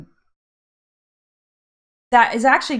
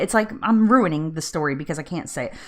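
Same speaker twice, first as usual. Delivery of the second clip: ordinary talk, nothing marked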